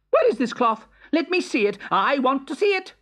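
high, impatient royal demand. Acted. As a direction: insist like a spoiled king. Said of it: "the Emperor demanded".

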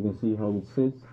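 muffled behind rubber mask; speaking through gas mask. Real, not acted. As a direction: voice through filter respirator. Seen 1: Rubber gas mask with filter covers whole face throughout labelled speech.

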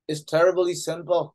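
slow, careful English reading. Acted. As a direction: read the cat's line slowly. Reads cat's line slowly.

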